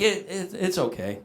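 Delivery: quiet, hesitant pretend reassurance; soothing reassurance. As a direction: hush it like a dad calming a child. Father consoling his sobbing child.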